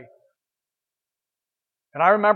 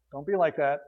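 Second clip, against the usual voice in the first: softer, lower repeat of "Don't be like that."; soft echo; 3 semitones lower, 6 dB quieter than usual. Acted. Paul repeating his warning to the Philippians, gently and softly. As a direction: let "Don't be like that." drop to a quiet plea.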